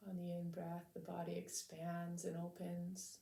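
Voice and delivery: calm, measured meditation voice with long pauses; unhurried, soothing lead-in to stillness; guided meditation. Real, not acted. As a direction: gently guide listeners to settle into the body.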